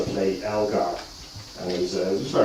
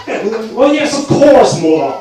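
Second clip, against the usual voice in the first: posh English with Dublin mother; 11 semitones higher, 7 dB louder than usual. Retold or imitated. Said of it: "my Dublin accent came out every single time".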